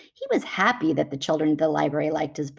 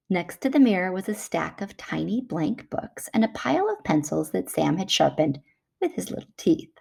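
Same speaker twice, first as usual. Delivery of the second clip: plain reading voice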